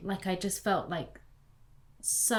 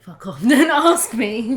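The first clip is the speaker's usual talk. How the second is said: loud, higher, drawn-out comic retort; laughing mock outburst; 5 semitones higher, 18 dB louder than usual. Snapping back at nosy questioners, laughing loud outburst. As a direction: burst out in mock exasperation, laughing.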